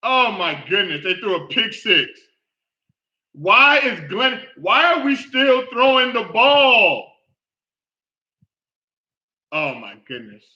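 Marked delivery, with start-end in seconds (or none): 3.3-7.1 higher, louder, slow and furious; real frustration, drawn out; exasperated outburst. Real, not acted. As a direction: demand it of the quarterback in disbelief. Furious at quarterback's throws.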